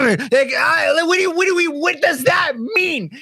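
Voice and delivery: high, spluttering bewilderment; baffled, high-pitched rant; exasperated. Acted. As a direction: stammer upward into an exasperated demand. Ranting at baffling client note.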